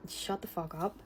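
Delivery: muttered annoyance. Retold or imitated. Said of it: "and I was like".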